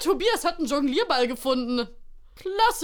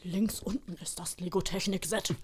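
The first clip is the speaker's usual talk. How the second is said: whispered tip; secret whisper; 2 semitones lower, 8 dB quieter than usual. Acted. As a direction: whisper it so the others miss it. Santa secretly tips the boy.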